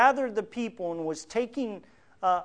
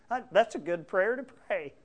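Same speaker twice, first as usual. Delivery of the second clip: ordinary talk, nothing marked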